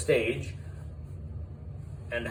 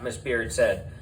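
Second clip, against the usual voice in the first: ordinary delivery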